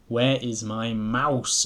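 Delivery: slow, emphatic "Where is my mouse?"; slow insistent "Where is my mouse?"; big-letters demand. A narrator's reading. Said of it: "that's in big letters".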